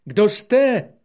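high, loud shouted question; calling out. Acted. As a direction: call out to the mountains. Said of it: "řekl malý princ". (volání do hor)

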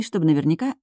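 ordinary delivery, nothing marked

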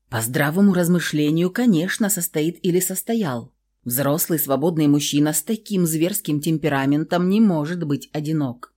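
ordinary delivery, nothing marked

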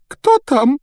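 very high, tearful-ghost call; piercingly high, wretched cry; miserable wail. A narrator's reading. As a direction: wail the question in a loud, high put-on voice. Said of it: "отозвалась несчастная Миртл".

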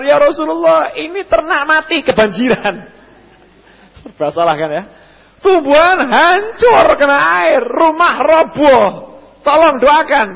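desperate plea. Retold or imitated. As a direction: beg as if your herd is drowning. Villager begs prayer against flood.

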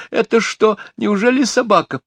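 astonished at the puppy; surprised lady. A narrator's reading. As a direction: gasp out the question, delighted. Lady sees a dog, amazed.

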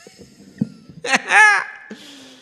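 high, loud shouted laugh; loud burst of laughter. Real, not acted. Laughing out loud at his own joke.

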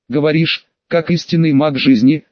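usual speaking voice

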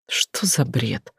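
breathless, hushed disbelief; barely voiced, slow and shocked; stunned whisper. Wife whispering in shock at husband's insult, hushed.

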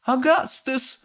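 high motherly cry; Mrs Gloop. A narrator's reading. Mother calls her son, high cry. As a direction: call out in a high woman's voice. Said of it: "раздался крик миссис Глуп".

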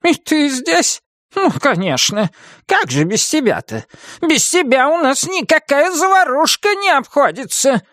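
high, irate witch voice; angry witch. A narrator's reading. Witch turns on Драко, angrily. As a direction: round on someone in high-pitched anger. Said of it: "гневно повернулась к нему Матильда".

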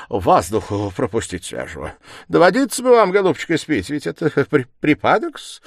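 high, concerned chatter; fussing investigator. A narrator's reading. Investigator fearing a fit in his guest, fussing.

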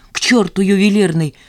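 tense, voiced outburst with no real whisper; barely restrained. A narrator's reading. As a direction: push it out, barely holding back. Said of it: "едва сдерживаясь, зашептала Жанна".

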